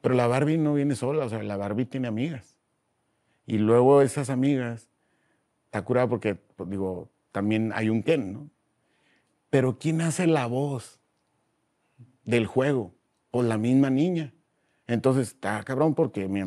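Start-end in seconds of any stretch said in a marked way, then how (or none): none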